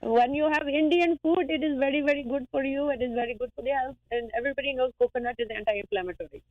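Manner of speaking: put-on Indian accent. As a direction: put on a cheerful Indian accent to praise the food. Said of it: "Oh, I haven't done accents for you guys".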